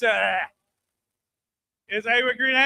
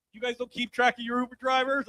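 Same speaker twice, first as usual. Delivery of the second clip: ordinary talk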